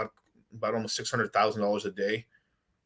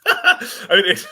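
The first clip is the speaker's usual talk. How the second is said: laughing fit; 6 dB louder, slower than usual. Real, not acted. Cracks up at his own slip.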